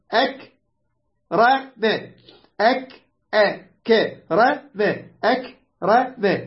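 broken into separate syllables with gaps. Shown as a demonstration. Teacher demonstrating a four-letter verb, syllable by syllable. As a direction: sound out أك ر م piece by piece.

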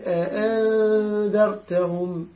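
slow, drawn-out tajweed chant; Quran recitation. Shown as a demonstration. Teacher recites the verse to his class, slow tajweed chant. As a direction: recite the verse slowly with full ghunna.

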